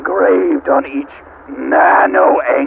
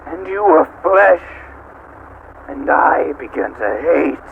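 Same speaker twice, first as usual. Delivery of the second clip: normal talking voice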